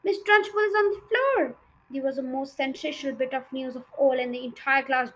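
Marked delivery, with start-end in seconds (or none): 0.0-1.6 high, loud excited scream; schoolboy screaming with glee. A narrator's reading. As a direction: shout the news to the class.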